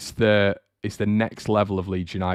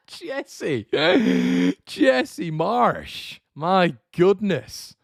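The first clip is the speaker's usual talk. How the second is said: high, slow drawn-out disbelief; incredulous exasperation; 12 semitones higher, much slower than usual. Real, not acted. Host shaking his head at the manager's quote. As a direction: say his name like you cannot believe it.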